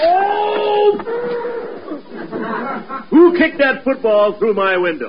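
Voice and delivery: high cry of outrage, then a scolding; outraged yell. Acted. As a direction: neighbor storming in. Angry neighbor bursting in.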